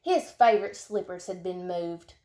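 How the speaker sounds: plain reading voice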